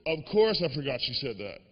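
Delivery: ordinary talk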